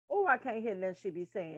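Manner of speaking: normal talking voice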